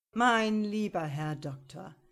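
a touch of scorn for the lawyer; mildly mocking. A narrator's reading. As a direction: prosecutor mocking the defence lawyer, smiling. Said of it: "lächelte etwas höhnisch der Staatsanwalt".

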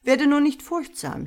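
put-on calm the boy with a lifted voice; reassuring Ole Lukoje. A narrator's reading. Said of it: "sagte Ole Lukoje".

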